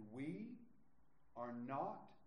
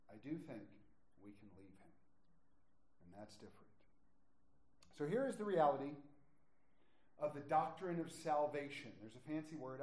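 ordinary talk, nothing marked

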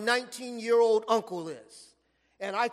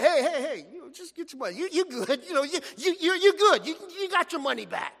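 higher, louder hurried hey hey hey; urgent uncle calling off; 6 semitones higher, 4 dB louder, faster than usual. Retold or imitated. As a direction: rush in to stop the fight, half laughing. Uncle pulls boy off bully.

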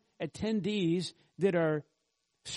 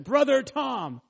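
higher, louder address to a congregant; calling out to a member; 7 semitones higher, 6 dB louder than usual. Retold or imitated. Preacher calling out a congregant's name, high and loud. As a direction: call his name out loud to grab attention. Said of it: "pointed to one of you and said".

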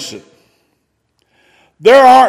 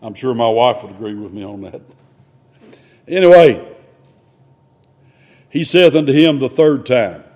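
usual speaking voice

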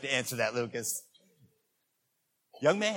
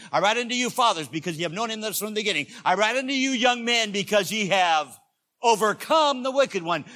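loud, high preacher's proclamation; forceful scripture reading; 7 semitones higher, 12 dB louder than usual. Acted. Preacher reading John's verse to the congregation, loud proclamation. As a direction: declaim the verse at full force.

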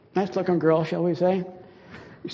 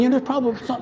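usual speaking voice

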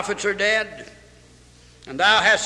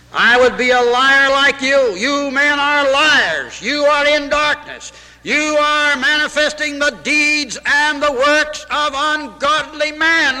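louder, higher, proclaiming Jesus' words; forceful preached quote; 6 semitones higher, 4 dB louder than usual. Acted. Preacher voicing Jesus rebuking the religious leaders, loud and forceful. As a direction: preach it out against the liars. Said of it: "Jesus Christ said".

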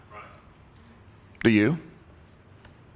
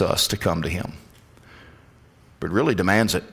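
spoken prayer; 3 semitones lower than usual. Acted. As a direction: pray it humbly and intimately. Opening prayer.

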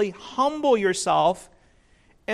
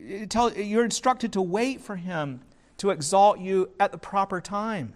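ordinary talk, nothing marked

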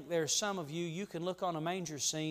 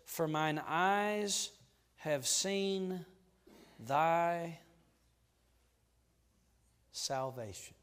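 very slow, each word set apart; a hushed, very slow scripture line; slow reverent reading; much slower than usual. Acted. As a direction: let the words land slowly, in awe. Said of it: "Now here it is".

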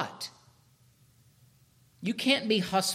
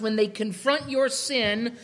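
usual speaking voice

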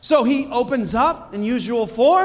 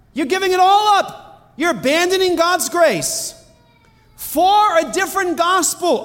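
higher, impassioned apostle's scolding; Paul scolding the Galatian church, pitched higher and impassioned; indignant rebuke; 8 semitones higher than usual. Retold or imitated. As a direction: cry out in disbelief at them.

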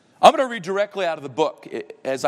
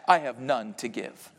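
much lower, softer mocking reply; devil's cold answer; 5 semitones lower, 5 dB quieter than usual. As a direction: answer flat and final.

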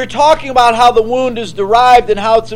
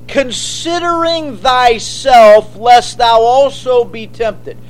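solemn scripture quote; slower than usual. Acted.

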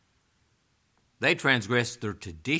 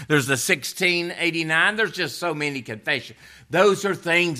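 raised pitch, emphatic pulpit delivery; impassioned preaching; 7 semitones higher, 7 dB louder than usual. Real, not acted. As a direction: press the charge on the congregation with force. Preaches at full force.

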